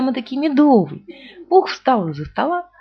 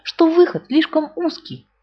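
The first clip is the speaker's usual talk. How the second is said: higher, slow huffy complaint; mild cross Pooh; 8 semitones higher, 3 dB louder, slower than usual. A narrator's reading. Pooh grumbles at rabbit, mildly cross. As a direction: blame the door, sulking. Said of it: "сердито сказал Пух".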